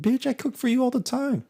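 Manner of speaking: shrill-pitched mock indignation; indignant retort. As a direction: squawk it back in mock outrage. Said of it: "I was like".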